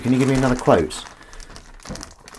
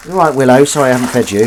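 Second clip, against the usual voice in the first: to the pet; 4 semitones higher, 9 dB louder, faster than usual. Real, not acted. Talking to pet.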